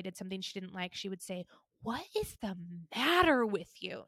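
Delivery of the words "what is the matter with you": a hint of a scolding mother; scolding mom. Daughter imitating her mother scolding her as a kid, mild mock scold. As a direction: lightly imitate mom's reproach. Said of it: "She'd say it exactly like that".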